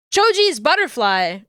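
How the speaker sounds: ordinary delivery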